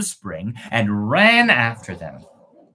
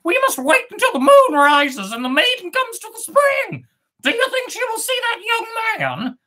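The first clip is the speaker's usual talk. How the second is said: first bird. Acted. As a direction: chirp it high as the bird.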